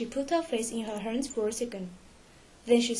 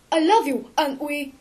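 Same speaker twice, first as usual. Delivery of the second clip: loud, raised-pitch declaration; crying out; 4 semitones higher, 5 dB louder than usual. A narrator's reading. Raoul declaring his love to Christine, crying out. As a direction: protest your love out loud. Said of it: "Raoul cried".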